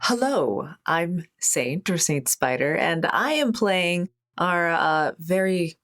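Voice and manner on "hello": ordinary delivery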